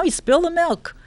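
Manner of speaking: ordinary talk, nothing marked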